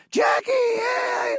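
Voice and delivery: fans shouting. Retold or imitated. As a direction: call out to him across the street. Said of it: "and be like".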